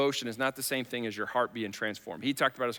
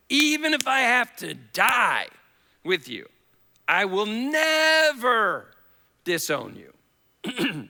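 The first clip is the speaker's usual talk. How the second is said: much higher, louder, very slow shouted vow; Peter's emphatic vow; 9 semitones higher, 7 dB louder, much slower than usual. Retold or imitated. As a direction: swear it with all your force, stretching never. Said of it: "looks at Jesus and says".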